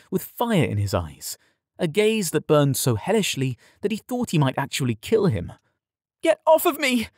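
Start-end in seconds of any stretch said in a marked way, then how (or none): 6.2-7.1 very high, panicked protest; desperate cry. A narrator's reading. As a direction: cry out to be released. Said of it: "he cried back".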